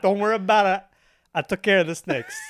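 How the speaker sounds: casual tough-guy mobster voice; Italian mobster. Retold or imitated. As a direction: reassure like a mob boss who handled it. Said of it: "an Italian mobster style".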